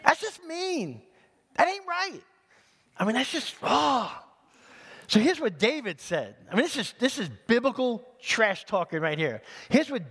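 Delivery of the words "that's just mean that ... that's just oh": high-pitched, drawn-out protest; mock outrage. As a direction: react with playful shock, pitch way up. Mock outrage at Goliath's threat.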